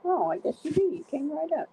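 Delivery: sing-song dog praise; pet talk. Real, not acted. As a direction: cheer the puppy on.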